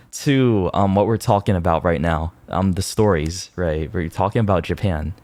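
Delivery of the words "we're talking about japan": usual speaking voice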